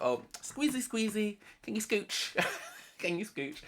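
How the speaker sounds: high, cutesy mock request; playful squeezing-in voice. Jasmine asking the pair to make room, playful high voice. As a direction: put on a squeaky little squeeze-past voice.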